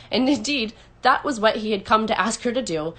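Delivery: plain reading voice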